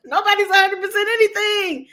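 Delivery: high, laughing through the words. Real, not acted. Amused at people's ancestry claims, laughing. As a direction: giggle as you drive it home. Laughs at her own point.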